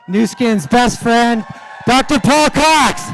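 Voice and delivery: host hypes the next speaker, slow and loud; announcer intro. Acted. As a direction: call the guest up like a ring announcer.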